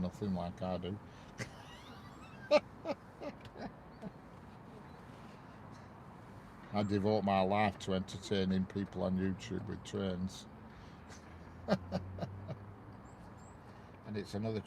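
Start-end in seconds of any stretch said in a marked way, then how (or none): 1.0-6.7 soft laugh. Real, not acted. Chuckling at own idle hobby. 10.5-14.1 soft laugh. Real, not acted. Laughing at own YouTube devotion.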